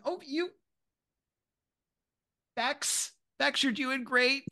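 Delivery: high, sugary boss praising the workers; overly sweet supervisor cheering on the staff; kindly manager. Acted. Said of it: "very kindly being like".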